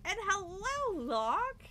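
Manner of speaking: bright, lifted hello; cheery greeting. Real, not acted. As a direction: sing out the hello. Greeting a chat viewer.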